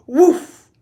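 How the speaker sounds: sudden loud high "woof"; explosive whoosh. A narrator's reading. Boy shooting up the pipe, explosive whoosh. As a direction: burst out the noise like a gunshot.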